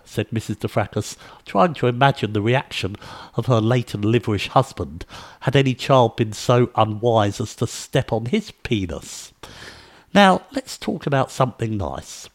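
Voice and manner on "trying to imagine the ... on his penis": usual speaking voice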